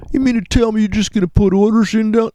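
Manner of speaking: high, incredulous put-on voice of a doubter; mock skeptic. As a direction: play the stunned sceptic who can't believe it. Mock skeptic questioning the trader.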